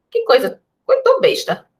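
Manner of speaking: high-pitched comic scoffing; put-on squeal at something silly; mock disbelief. Teacher acting a reaction to the lost trema, high mock disbelief.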